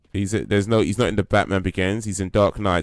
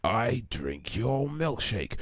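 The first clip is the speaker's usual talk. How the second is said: slow, softer, swooping film villain quote; Daniel Plainview; 4 semitones higher, 7 dB quieter, slower than usual. Acted. Streamer repeating There Will Be Blood quote, slow Plainview impression. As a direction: drag each word out, quietly theatrical. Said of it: "Daniel Plainview".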